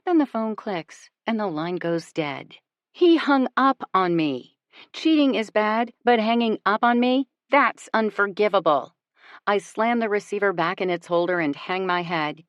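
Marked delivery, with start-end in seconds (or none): 2.9-8.9 louder, outraged and emphatic; indignant. A narrator's reading. Narrator fuming after he hangs up, indignant. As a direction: spit out the outrage.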